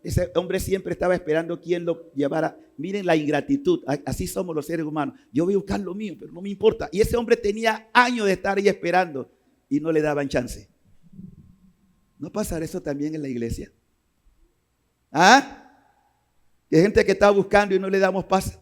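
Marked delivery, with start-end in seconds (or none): none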